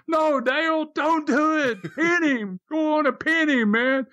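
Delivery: very high, urgent mock yell; retold scream. Teller shouting to Dale from the second floor, retold scream. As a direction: imitate calling desperately across a hall, no real volume. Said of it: "So I start screaming".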